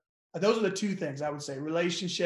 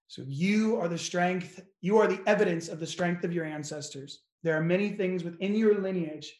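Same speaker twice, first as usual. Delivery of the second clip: ordinary talk, nothing marked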